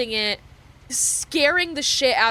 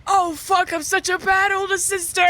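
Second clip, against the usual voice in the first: higher, hammed-up self-reproach; mock despair; 8 semitones higher than usual. Eldest sister hearing the snowman song, higher mock anguish. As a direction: wail it like a guilty big sister. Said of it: "I was like".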